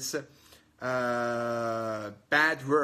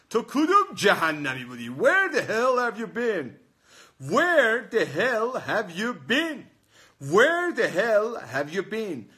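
exasperated demand; 6 semitones higher, 5 dB louder than usual. Shown as a demonstration. Teacher demonstrates asking where someone has been, exasperated. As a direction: act out an indignant question for the class. Acting out the angry example question.

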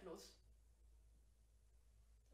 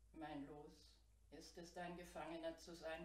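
ordinary delivery

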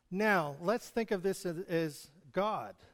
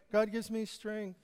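much softer echo of the promise; 2 semitones higher, 5 dB quieter than usual. Shown as a demonstration. Preacher echoes the promise back softly. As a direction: answer it back quietly like an echo. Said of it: "what you send out".

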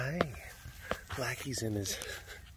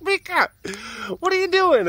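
high-pitched mock dismay; acted baffled outcry to a pet; startled scolding; 14 semitones higher than usual. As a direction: call out in squeaky disbelief. Acted startled reaction to the cat.

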